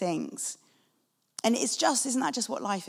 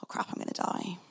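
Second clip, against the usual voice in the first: softer, breathless whispered panic; panicked whisper; 2 semitones lower, 8 dB quieter, faster than usual. Retold or imitated. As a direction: whisper it in sudden terror. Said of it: "he panics and he goes".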